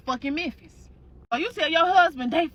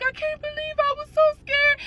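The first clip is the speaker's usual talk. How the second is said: put-on high child voice, close to tears; young son. Crying son telling his mother, high child voice. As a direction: pitch it up as a scared little boy.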